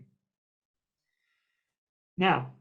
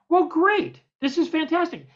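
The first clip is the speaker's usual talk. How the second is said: much higher, louder mock enthusiasm; delighted producer; 9 semitones higher, 5 dB louder than usual. Storyteller imitating producer, much higher delighted gush. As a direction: gush like an eager salesman.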